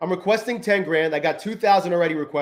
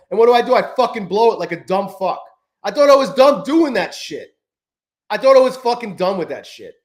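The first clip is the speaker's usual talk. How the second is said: much louder, higher furious rant; angry outburst; 4 semitones higher, 5 dB louder than usual. Real, not acted. Trader ranting to his stream about blowing his accounts, furious loud. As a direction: vent real rage at yourself. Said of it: "I'm so fucking pissed about that still".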